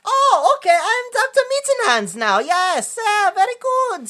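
high, hammed-up cartoon German accent; cartoonish German accent. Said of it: "he has the most cartoonish German accent".

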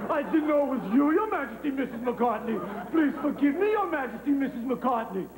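grovel as if before royalty; overdone fawning apology, pitch swooping up; grovelling apology. Acted. Grovels after insulting [name].

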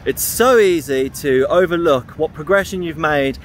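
ordinary talk, nothing marked

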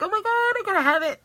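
fast, high-pitched excited gush. Retold or imitated. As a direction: relive the must-have moment, pitch jumping up. Retells her excitement on seeing it.

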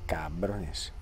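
whispered curse; cursing under breath. Real, not acted. Muttered after ammo complaint.

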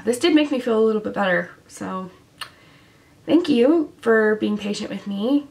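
ordinary talk

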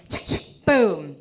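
rifle cocking, then a low boom; imitated rifle salute. Honor guard firing salute, imitated gun sounds. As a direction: mouth the gun noises slowly. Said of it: "march up and go".

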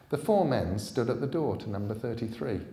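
measured storyteller reading; story narration. A narrator's reading. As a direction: tell it like a dark fairy tale. Narration of audiobook.

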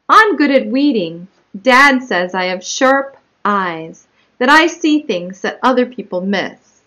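animated story narration with punched key words; picture-book read-aloud. Acted. Child narrator telling of her own spot, read as lively story narration. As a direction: read it out clearly and expressively to young children.

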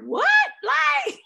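high, loud disbelieving yelp; shocked what. Retold or imitated. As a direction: squeak out your disbelief. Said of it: "I'm like".

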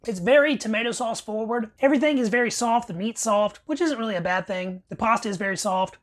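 chewing between words; talking around a mouthful; mouth full of food. Real, not acted. Seen 0: Bite taken at -1.0; mouth works food while speaking afterward.

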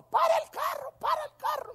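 very high-pitched, put-on granny cry; shrill old lady. Old lady flags down the preacher's car, shrill mock cry. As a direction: squawk it in a high little old-woman voice.

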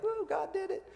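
high, wide-eyed mock amazement; a lightly voiced stunned onlooker; amazed whoa. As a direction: gasp it out like a stunned crowd. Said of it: "They're like".

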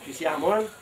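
ordinary delivery

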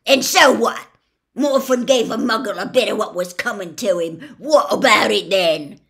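loud, defiant and scornful; belligerent. A narrator's reading. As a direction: throw it back in his face. Gaunt defends his son angrily.

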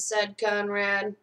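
usual speaking voice

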